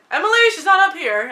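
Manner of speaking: mock dad shouting downstairs to Emily; dad covering for daughter. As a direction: call out to cover for her, playing the dad. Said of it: "And he's like".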